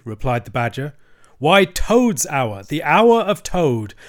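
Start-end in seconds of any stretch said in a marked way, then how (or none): 1.4-3.9 slow, loud, weighty pronouncement; grave declaration. Acted. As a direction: declare it slowly like a verdict. Badger declares Toad's reckoning.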